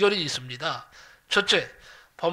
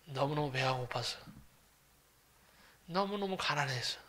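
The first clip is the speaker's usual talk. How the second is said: ordinary delivery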